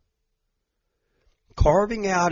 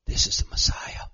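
hushed whisper of wonder; whispered awe; 3 dB quieter than usual. Acted. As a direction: breathe it in reverence. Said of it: "They said".

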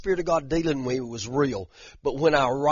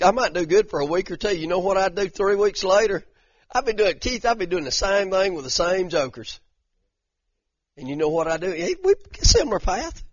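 normal talking voice

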